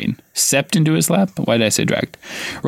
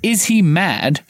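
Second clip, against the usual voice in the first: shocked; 6 semitones higher, much slower than usual. A narrator's reading. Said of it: "Ron gasped".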